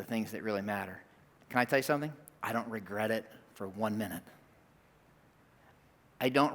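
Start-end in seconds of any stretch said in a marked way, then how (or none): 2.4-4.2 low, soft confession; soft, low and heartfelt; hushed sincerity. Real, not acted. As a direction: confide it quietly, dropping well below your usual voice. Sincere confession, then repeated.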